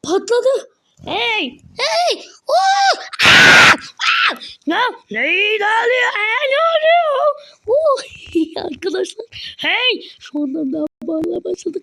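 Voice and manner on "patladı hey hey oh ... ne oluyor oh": high squealing yells; excited shout. Real, not acted. Reacting to the exploding village, high excited yelling. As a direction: shriek with chaotic glee.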